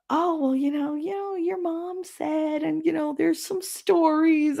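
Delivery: high, slow, sing-song mock gossip; a high, drawn-out imitation of a nosy stranger; gossipy mock voice. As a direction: do a prim busybody hinting at rumours.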